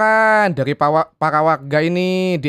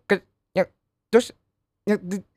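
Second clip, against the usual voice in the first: choppy phone line; 4 semitones higher than usual. Pretend. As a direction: voice cutting out like a bad signal. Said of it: "ini suaranya putus-putus mulu".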